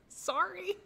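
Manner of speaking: high, squeaky mock-helpless sorry; sheepish apology. Apologising to a child who tries Czech, sheepish squeak. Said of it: "I have to be like".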